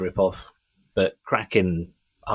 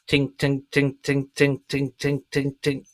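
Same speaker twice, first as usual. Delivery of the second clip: high, evenly repeated piano plinks; ting like one piano key hit over and over; imitated repetitive piano; 6 semitones higher, slower than usual. Shown as a demonstration. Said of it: "which is just a piano".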